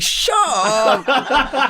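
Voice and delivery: very high, loud mock disbelief; incredulous squeal. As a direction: squeal it as if the offer is absurd. Bigger disbelief at being offered Superman.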